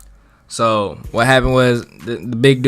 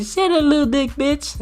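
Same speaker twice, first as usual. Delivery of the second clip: mock girl voice; 4 dB quieter than usual. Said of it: "And she was like".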